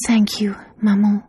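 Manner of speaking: grateful hushed whisper. A narrator's reading. Daughter's grateful farewell.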